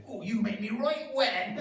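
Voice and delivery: high, slow West Country burr; West Country accent. Retold or imitated. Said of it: "lovely West Country accent".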